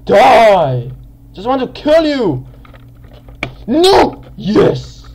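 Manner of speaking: high, loud battle yells; aggressive gamer yelling. Real, not acted. Gamer desperate to kill an opponent, aggressive shouting. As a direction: shout each burst in the heat of attack. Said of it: "sometimes a bit aggressive in games".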